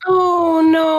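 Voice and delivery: loud, high "oh no"; dismayed outcry. Real, not acted. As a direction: cry out in comic dismay. Dismayed reaction to a word lookup.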